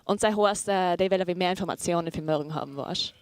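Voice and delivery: casual commentary in German dialect; chatting along in a regional German dialect. Shown as a demonstration. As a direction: speak in your own German dialect. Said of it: "tell us what's happening ... in your dialect".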